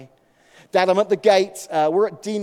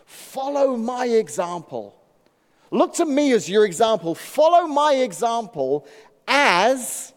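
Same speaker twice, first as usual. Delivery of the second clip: slow, louder scripture proclamation; raised, deliberate preaching of the verse; preaching emphasis; 6 semitones higher, 6 dB louder, slower than usual. Acted.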